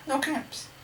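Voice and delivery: high, small voice of dismay; puzzled discovery. A narrator's reading. Peddler looking round for his caps, puzzled high voice. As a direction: pitch it right up, as if finding nothing.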